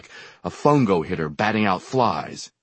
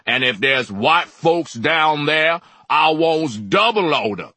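loud commanding boom; booming demand for order; 9 semitones higher, 7 dB louder, slower than usual. A narrator's reading. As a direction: fill the room with it. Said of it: "Supercargo boomed".

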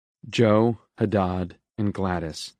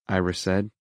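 ordinary delivery

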